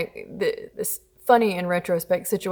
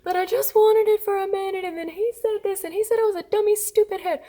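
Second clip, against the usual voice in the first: much higher, mock-tearful little kid voice; crying child tattling; 12 semitones higher than usual. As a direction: imitate a whiny child telling on a sibling. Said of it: "They're laying there crying".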